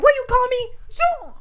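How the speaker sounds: mock squeal. Retold slap scene, squealing.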